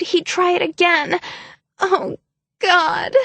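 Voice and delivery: high, tearful, breaking sobbing plea; frantic tears. Acted. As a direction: sob out the story as if it hurt. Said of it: "burst into frantic tears".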